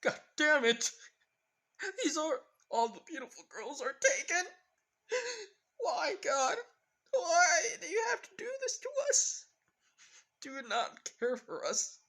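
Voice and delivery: hammed-up despair, howling at God; mock anguished wail. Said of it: "crying with blood".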